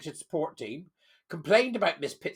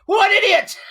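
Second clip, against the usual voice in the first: much higher and louder, scornful; exasperated outburst; 13 semitones higher, 12 dB louder than usual. Real, not acted. Host reacting to the dog owner's complaint, loud scornful blurt. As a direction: blurt it out in disbelief.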